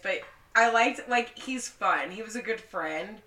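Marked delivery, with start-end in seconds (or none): none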